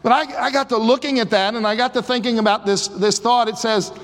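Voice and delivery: preacher driving the point home; fervent preaching. Acted. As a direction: build the sermon with rising fervor. Preaching at full force.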